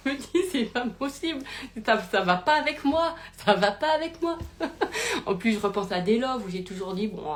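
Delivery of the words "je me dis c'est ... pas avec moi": laughing disbelief. Real, not acted.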